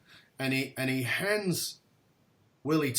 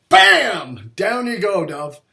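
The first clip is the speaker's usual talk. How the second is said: higher, loud teasing knockdown call; playful mock knockout; 6 semitones higher, 11 dB louder, slower than usual. Real, not acted. Host ribs the guest after his story, loud and playful. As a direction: celebrate the story's punch, playful.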